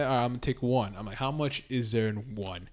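low, soft thinking-aloud voice; musing to himself. Retold or imitated. Friend retelling his thoughts before taking an edible, musing to himself. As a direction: drop low and soft, as if quietly weighing it up.

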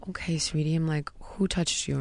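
soft, whispery reassurance; retold hushed, careful response; hushed gentle parent. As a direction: imitate a mother keeping her voice down for her child. Mother gently questioning her daughter.

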